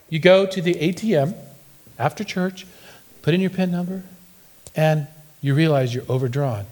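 ordinary talk, nothing marked

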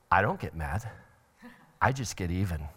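low, soft, contained threat; simmering anger. Acted. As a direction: keep it quiet and vengeful, holding the anger in. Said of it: "this simmering kind of anger".

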